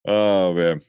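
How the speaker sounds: usual speaking voice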